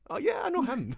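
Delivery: high-pitched realisation, played for laughs; sudden recognition. Dundonian admitting they do know Barry, comic high-pitched recognition. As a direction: act out suddenly placing the name.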